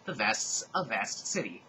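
usual speaking voice

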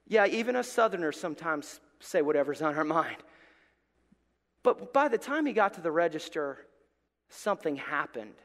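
ordinary talk

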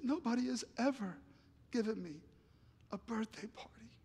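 high, soft voice fading to a whisper; imitated crying. Bob thanks his friend for the party, tearful. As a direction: act choked up, trailing into near silence. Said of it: "he just started crying and bawling".

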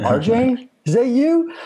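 high-pitched retold double take; surprised recognition. Acquaintance spotting the speaker by surprise, high surprised recognition. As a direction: call it out high, in amazed recognition.

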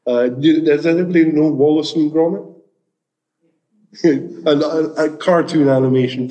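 ordinary talk, nothing marked

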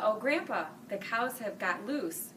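ordinary delivery, nothing marked

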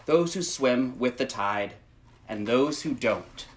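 gentle, measured picture-book read; reading to children. Acted. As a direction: read it slowly to little listeners. Reads picture book aloud.

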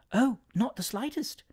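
high, softly strained politeness; reluctant politeness. A narrator's reading. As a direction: agree while plainly objecting. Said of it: "in a tone which clearly showed".

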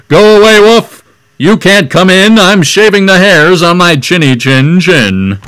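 loud, high shout through the door; pig yelling back. Retold or imitated. Pig shouting at the wolf to go away, loud and high. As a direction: yell him away from the door. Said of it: "He yelled back".

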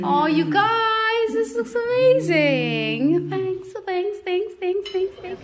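high, slow, overjoyed thanks; delighted gushing. Real, not acted. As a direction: gush with happy surprise. Surprised by the breakfast.

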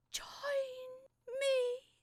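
ghostly call. Acted. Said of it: "She mouthed the same words".

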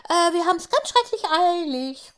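high, loud hurried call. A narrator's reading. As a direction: shout it while running off. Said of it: "ruft Tafiti".

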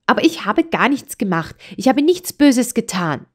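high, fast, agitated venting; upset child. A narrator's reading. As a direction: let it all out, hurt and indignant. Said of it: "rief es aufgeregt". (aufgebrachtes Kind, hoch und hastig)

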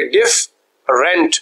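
डिफ, pause, rent; syllable by syllable. Shown as a demonstration. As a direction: break the word into डिफ and rent with a clear gap. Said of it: "हिजे बनाने का unit है". (हिज्जे करते हुए: डिफ… rent)

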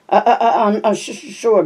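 a hint of a stuttering boy, stumbling before "sure"; stuttering reply. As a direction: stammer through to "sure", held back and nervous. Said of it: "The only stuttering little freak".

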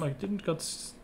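ordinary talk

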